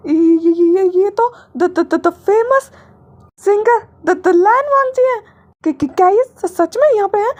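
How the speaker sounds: high, excited stammering fan; starstruck stammer. A narrator's reading. Assistant spotting the famous singer, excited stammer.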